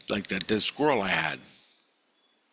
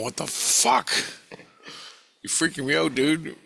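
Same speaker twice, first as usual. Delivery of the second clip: retold freak-out, pitched well up; 7 semitones higher than usual. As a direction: act out alarmed disbelief in a higher voice. Acted freaked-out reaction to creature.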